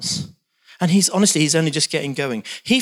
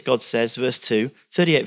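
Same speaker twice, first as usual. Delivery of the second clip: ordinary talk, nothing marked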